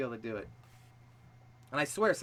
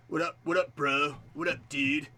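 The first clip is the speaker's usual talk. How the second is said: casual American bro greeting; American dude-speak; American accent. Shown as a demonstration. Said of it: "Do an American accent".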